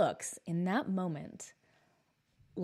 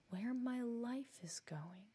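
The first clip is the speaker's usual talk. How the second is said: slow, breathy whisper to herself; woman on the bus whispering to herself in shock; muttered whisper; 4 semitones higher, 10 dB quieter, much slower than usual. Retold or imitated. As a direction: mutter it under your breath, still stunned. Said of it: "She keeps muttering to herself".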